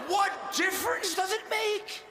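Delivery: high, outraged repeat; outraged shout. Acted. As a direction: throw the question back in disbelief.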